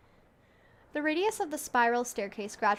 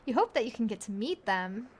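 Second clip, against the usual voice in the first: ordinary talk, nothing marked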